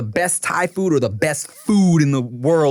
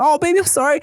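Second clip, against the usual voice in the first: high, cooing parent voice; retold pleading apology; 11 semitones higher, 3 dB louder, faster than usual. Dad consoling his scared daughter, retold pleading apology. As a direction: soothe a small child in a high voice.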